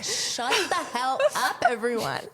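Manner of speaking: mock plea for everyone to shut up, slow and high; exasperated. Bride-to-be retelling her reaction to family spilling the secret, exasperated.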